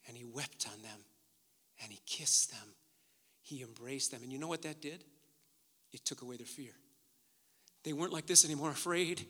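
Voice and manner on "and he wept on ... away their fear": very soft, low retelling; hushed reverent telling. Real, not acted. Preacher telling of Joseph embracing his brothers, hushed and reverent. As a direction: let it sink almost to a murmur.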